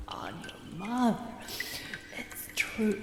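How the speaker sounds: slurred whispered drift; drowsy whisper. Acted. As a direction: whisper it half asleep. Drunk aunt drifts off.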